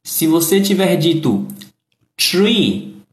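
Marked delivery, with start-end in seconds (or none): 2.2-3.0 "tree" said with a plain t, no th; the mistake sound; wrong th as t. Shown as a demonstration. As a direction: tr instead of th. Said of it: "você falou árvore".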